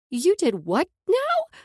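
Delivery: slow stunned outburst ending in a shriek; rising to a shriek. A narrator's reading. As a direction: disbelief building word by word until it breaks. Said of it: "rose with every word until the last one came out as more of a shriek".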